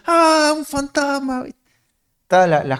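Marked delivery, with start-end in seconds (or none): 0.1-1.4 high, drawn-out mock scream of fright; grito de miedo imitado. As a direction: act out terrified disciples with a long, high-pitched wail. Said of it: "los discípulos gritando". (grito agudo y alargado, fingiendo susto)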